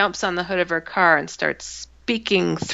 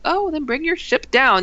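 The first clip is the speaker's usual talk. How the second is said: higher-pitched mock-helpful challenge; smart aleck; 7 semitones higher than usual. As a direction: toss it back like a smart aleck. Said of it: "I'm being a smart aleck".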